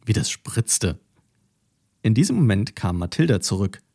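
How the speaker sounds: plain reading voice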